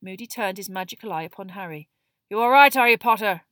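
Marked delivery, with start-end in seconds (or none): 2.3-3.5 loud, raised, gruff question; gruff challenge. A narrator's reading. Moody turns his eye on Harry, loud and gruff. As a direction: turn on the pupil and check him.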